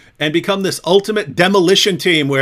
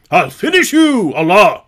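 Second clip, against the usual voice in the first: much higher, loud, strained bragging voice; mocking impression of Hijab; 4 semitones higher, 6 dB louder than usual. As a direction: squeal it like a puffed-up bully. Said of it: "That's how he actually talks".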